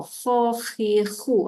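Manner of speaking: "kha, khi, khu" scraped in the throat; voiceless throaty scrape on "kha, khi, khu"; phlegm-clearing kh. Shown as a demonstration. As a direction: rasp the "kh" as if hawking up phlegm. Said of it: "betul-betul macam nak keluarkan kahak".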